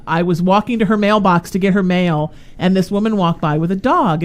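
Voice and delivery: normal talking voice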